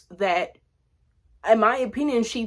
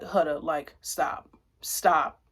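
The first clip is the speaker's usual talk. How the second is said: calm firm plea; 6 semitones lower, 4 dB quieter, slower than usual. Retold or imitated. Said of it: "Elandria was like".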